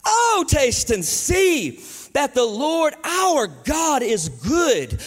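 slow, high, drawn-out preacher's proclamation; amplified reading. Shown as a demonstration. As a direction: proclaim each phrase big and slow. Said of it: "just to amplify it".

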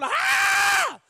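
piercing high, loud scream; shouting in praise. Retold or imitated. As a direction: let out a hammed-up scream of praise. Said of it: "She's shouting. I'm driving".

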